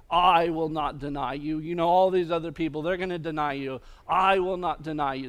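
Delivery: loud, forceful vow of loyalty; emphatic cry. Acted. Disciple vowing to Jesus he won't deny him, emphatic cry. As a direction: declare it loudly, hitting each vow hard. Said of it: "it's not the emphatic cry".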